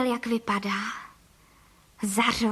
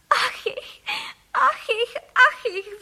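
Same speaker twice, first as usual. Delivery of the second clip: high-pitched theatrical sobs; sobbing; 11 semitones higher, 3 dB louder, much slower than usual. A narrator's reading. Wife who finds she has wings for arms, sobbing. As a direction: wail it in little high bursts. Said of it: "vzlikala paní Frejková".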